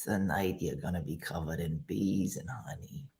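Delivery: low, hushed mock prophecy; mock-ominous murmur. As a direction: make a creepy threat, very quiet and low. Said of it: "Say something ominous".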